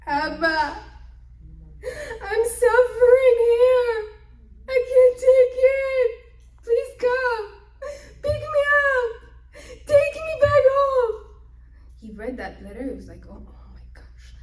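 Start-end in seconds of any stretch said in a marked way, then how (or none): 0.1-11.2 high, loud sobbing cry for rescue; desperate crying plea. Acted. Youngest daughter begging her father in a letter, loud high sobbing. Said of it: "And the letter says". 13.3-14.4 breathless hushed gasp; shocked whisper. Retold or imitated. Father reading the daughter's letter, shocked whisper.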